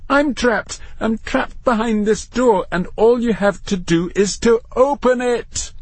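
high, exasperated pleading protest; expostulating. A narrator's reading. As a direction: protest at the top of your register. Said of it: "expostulated Matthew".

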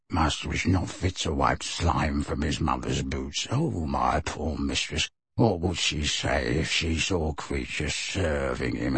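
low, soft, furious elf mutter; muttered fury. A narrator's reading. The elf cursing his master, low furious mutter. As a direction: seethe it under the breath, low. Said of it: "then muttered furiously".

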